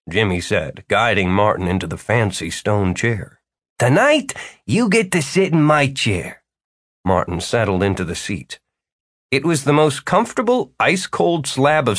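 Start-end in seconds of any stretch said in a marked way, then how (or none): 3.8-6.4 jovial host voice. A narrator's reading. Jimmy continues.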